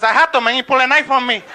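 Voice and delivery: loud, high protest; hammed-up bartender defending himself; Pablo. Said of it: "Pablo says".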